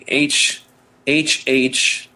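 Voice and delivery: ordinary delivery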